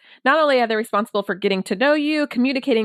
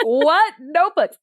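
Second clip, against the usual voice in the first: exasperated repeating; 6 semitones higher, slower than usual. Retold or imitated. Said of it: "And his son was like".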